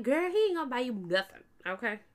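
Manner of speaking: fast, loud, sassy put-down; a fast, sassy "girl"; sassy retort. Retold or imitated. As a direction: tell her off with attitude. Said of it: "I was like".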